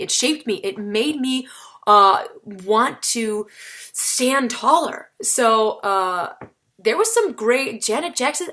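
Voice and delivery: normal talking voice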